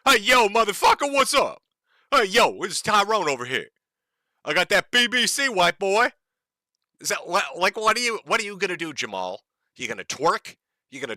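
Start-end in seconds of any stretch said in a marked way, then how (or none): 0.1-6.1 hammed-up street tough, higher and louder; brash put-on tough-guy voice. Commentator mocking the angry protester, hammed-up tough guy.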